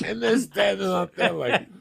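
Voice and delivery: high, laughing while telling; real giggling narration; laughing through it. Real, not acted. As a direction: talk through helpless laughter.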